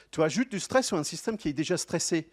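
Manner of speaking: usual speaking voice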